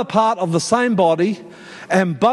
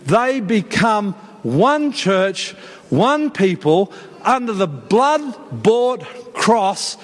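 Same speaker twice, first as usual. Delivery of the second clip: high, deliberate preaching cadence; emphatic preaching; 5 semitones higher, slower than usual. Real, not acted. Preacher rallying the church round one gospel, emphatic preaching. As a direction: drive home each phrase with weighty pauses.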